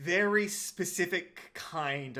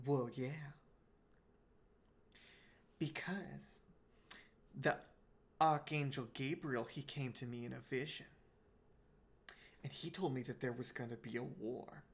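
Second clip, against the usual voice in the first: put-on much softer, secretive old lady voice; hushed confiding; 10 dB quieter than usual. Old woman tells the clerk about a vision of war, hushed and secretive. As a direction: hush it down like sharing a secret prophecy.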